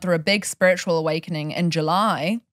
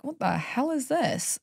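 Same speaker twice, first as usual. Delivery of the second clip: high, slow, baffled question; bewildered quote; 7 semitones higher, 5 dB quieter, slower than usual. Retold or imitated. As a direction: drag it out in disbelief. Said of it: "and be like".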